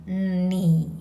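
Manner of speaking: ni dipped to the lowest note, third tone. Shown as a demonstration. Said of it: "Yeah, the lowest note".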